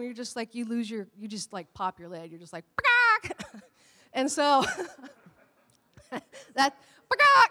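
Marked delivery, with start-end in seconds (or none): 2.8-3.6 a sharp chicken squawk, high and loud. Retold or imitated. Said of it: "That's exactly how I sound when I". 7.1-7.5 a piercing chicken squawk, much higher and louder. Retold or imitated. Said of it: "I sound like a chicken".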